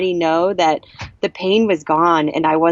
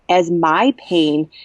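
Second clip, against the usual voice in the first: ordinary delivery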